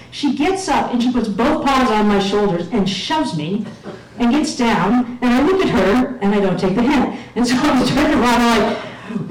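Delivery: usual speaking voice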